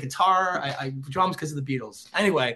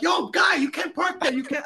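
much higher, louder put-on voice calling out; attendant yelling; 12 semitones higher, 4 dB louder than usual. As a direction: mimic a stranger yelling a warning. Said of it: "someone's like".

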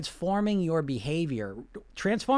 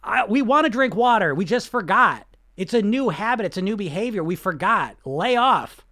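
loud, higher, firm coach; 5 semitones higher, 7 dB louder than usual. Acted. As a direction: shout down the inner critic like a coach. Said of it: "the coach voice".

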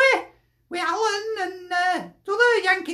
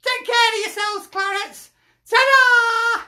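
high, loud sign-off shout; big, raised farewell call; 4 semitones higher, 5 dB louder than usual. Real, not acted. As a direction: belt out a cheery goodbye. Calling out a sign-off.

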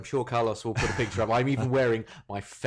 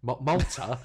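mock disbelief; 3 semitones higher, slower than usual. Real, not acted. Mock disbelief at host's travels.

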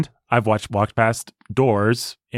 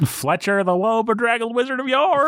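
high, hammed-up fantasy title; theatrical announcement; 12 semitones higher than usual. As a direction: proclaim the wizard's title, pitched way up.

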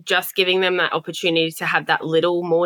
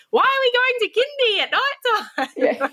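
put-on excited child voice. Kids voiced in story.

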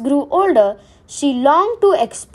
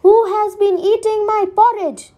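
higher-pitched mother bear, grumbling; a mildly grumbling mother bear voice, pitched up; 8 semitones higher than usual. A narrator's reading. Mother Bear finds her porridge touched, higher grumbling voice. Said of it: "Mother Bear grumbled".

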